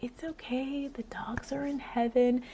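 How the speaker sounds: hushed, whispery imitation of comforting; soothing whisper. Another mom soothes the crying girl, imitated soothing whisper. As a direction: whisper it softly, high and consoling. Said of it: "she's trying to soothe her".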